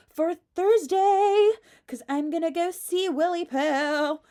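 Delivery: high, loud squeal of anticipation; gushing excitement. Real, not acted. Vlogger telling viewers she's seeing Willy Poo Thursday, gushing excitement. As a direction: build to a singsong "Ah!".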